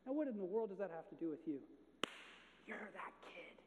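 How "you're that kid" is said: preaching build. Acted. Sermon application build.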